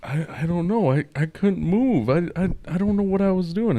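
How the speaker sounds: retelling the dad stammering after the VR panic, dazed stammer. Retold or imitated. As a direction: shaken confused voice. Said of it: "And he's like".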